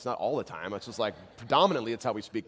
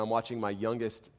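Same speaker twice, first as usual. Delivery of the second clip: usual speaking voice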